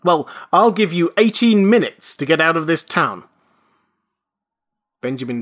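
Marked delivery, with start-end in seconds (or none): 0.0-3.2 higher and louder outraged shout. A narrator's reading. Registrar shouts Benjamin out of town, outraged. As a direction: bellow with outrage. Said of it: "he shouted".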